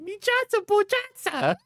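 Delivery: squeaky, very high nonsense words; squeaky echo of Watto; mock alien gibberish.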